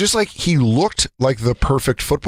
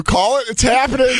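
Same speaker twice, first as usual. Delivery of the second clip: high, frantic pleading to snap the ball; mock panic; 10 semitones higher than usual. Kid in a stance begging to snap the ball, frantic high squeal. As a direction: squeal it like you're about to tip over.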